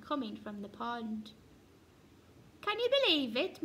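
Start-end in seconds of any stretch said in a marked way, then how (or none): 2.6-3.6 high, quick, loud disbelief; indignant grumble. A narrator's reading. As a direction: frogs complaining in outrage. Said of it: "muttered the frogs".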